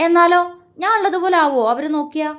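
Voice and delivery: high, quick sulky protest; pouting. A narrator's reading. Daughter doubting others' care for her father, pouting quickly. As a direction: object fast through a pout. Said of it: "ചുണ്ടുകൾ കൂർത്തു വന്നു".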